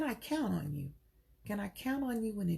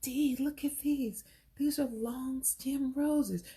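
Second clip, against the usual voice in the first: slow, higher, awed voice; admiring friend; 4 semitones higher, much slower than usual. Retold or imitated. Harriet showing Dee the roses, slow and awed. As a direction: linger over the roses in awe. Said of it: "And so Harry's like".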